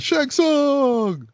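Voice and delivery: high-pitched mock cry of the villain's name; mock dramatic yell. Mocking the hero calling out the villain, high hammed yell. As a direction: ham up the shout like the movie hero. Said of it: "But he says it like that".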